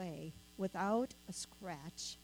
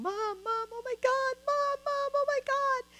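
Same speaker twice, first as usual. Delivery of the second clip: imitating high, panicked cries of mom, a little louder; reliving her daughter's terrified scream, pitched way up; retold panicked screaming; 5 dB louder than usual. Mother retelling her daughter screaming for her after the crash, imitated panicked high cries. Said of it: "I hear her screaming and saying".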